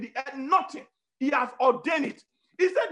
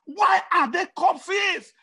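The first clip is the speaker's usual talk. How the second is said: slow, loud and higher, demanding an answer; preaching shout; 6 semitones higher, 6 dB louder, much slower than usual. Acted. Preacher challenging his congregation, slow booming shout. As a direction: hurl the question at the crowd.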